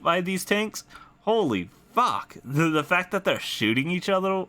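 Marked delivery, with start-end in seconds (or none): none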